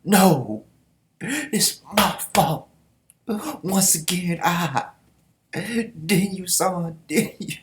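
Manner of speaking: high, slow cry of self-blame; hammed-up grieving general; anguished guilt. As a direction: break down taking the blame. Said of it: "this Kanto".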